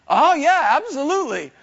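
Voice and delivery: high, loud, bright-eyed yes; eager agreement. Retold or imitated.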